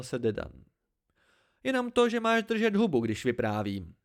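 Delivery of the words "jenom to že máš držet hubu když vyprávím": high, loud snap that falls away; snapped retort. A narrator's reading. Hespe telling Dedan to stop interrupting her tale, snappy.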